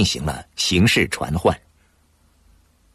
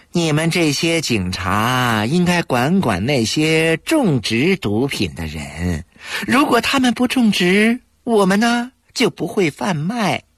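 self-righteous; 10 semitones higher than usual. A narrator's reading. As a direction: brazenly scold the young cops. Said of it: "理直气壮地教育".

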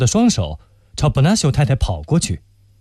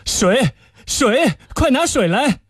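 urgent call for water; 10 semitones higher, slower than usual. Acted.

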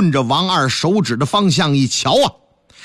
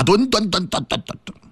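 gulping sound; 4 dB quieter than usual. Acted. Gulping wine from gourd.

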